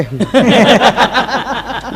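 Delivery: high laugh. Real, not acted. Laugh after shayari.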